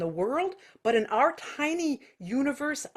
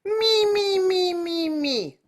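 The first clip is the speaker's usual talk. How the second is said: high nasal me calls; 8 semitones higher, 4 dB louder, much slower than usual. Shown as a demonstration. Teacher modelling the nasal me drill, high. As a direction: ring me through the nose.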